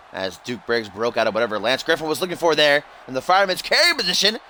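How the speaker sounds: ordinary talk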